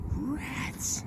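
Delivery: whispered disappointed rats. Real, not acted. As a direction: breathe out a high little rats. Broken find, little curse.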